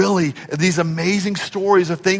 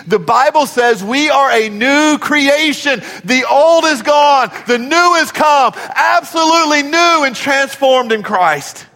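preaching shout; 8 semitones higher than usual. Real, not acted. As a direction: preach it out at full force. Preacher proclaims new creation.

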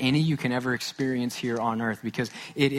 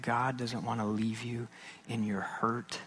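hushed reassurance; 8 dB quieter than usual. Real, not acted. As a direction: lean in and whisper comfort. Pastor comforting hurting listeners.

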